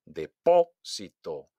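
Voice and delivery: slow "Depósito", punched on PÓ; exaggerated second-syllable stress. Shown as a demonstration. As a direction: hit PÓ in a drawn-out "Depósito". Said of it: "exagerar la pronunciación". ("Depósito" lento, con golpe en PÓ)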